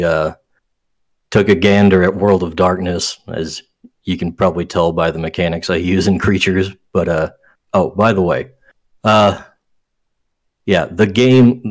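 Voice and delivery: usual speaking voice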